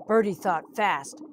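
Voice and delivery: ordinary delivery, nothing marked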